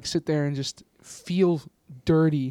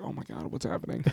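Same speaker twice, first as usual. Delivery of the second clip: whispered, much softer shocked aside; 5 semitones lower, 13 dB quieter than usual. Retold or imitated. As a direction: whisper it like a stunned audience member. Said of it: "everyone's like".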